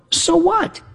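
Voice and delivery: high, rising scoff; mock scoffing. Interrogator dismissing a killer's excuse, high and scornful. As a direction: wave it off with scorn.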